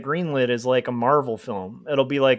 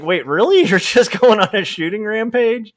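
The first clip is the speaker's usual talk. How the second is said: louder, laughing disbelief; incredulous reaction; 6 semitones higher, 4 dB louder than usual. Retold or imitated. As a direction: react like you can't believe it. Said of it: "Then it's like".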